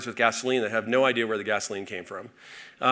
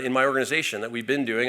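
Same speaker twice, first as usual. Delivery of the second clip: usual speaking voice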